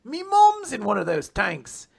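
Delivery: loud mock Cockney, slowed at the end; Wally's Cockney voice. Retelling Wally's shock at the tanks, loud mock Cockney. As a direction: bellow it like a cheeky London lad.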